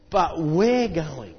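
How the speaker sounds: WE'RE drawn out slowly; stress on WE'RE. Real, not acted. Preacher telling congregation who must act, long stress on WE'RE. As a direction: lean long and slow on "we're".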